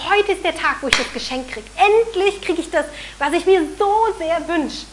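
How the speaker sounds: höher, hell begeistert vor Vorfreude; aufgeregtes Kind. Retold or imitated. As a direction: wie ein Kind, das endlich sein Geschenk bekommt. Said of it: "sich total freut und denkt". (aufgeregte Kinderstimme voller Vorfreude)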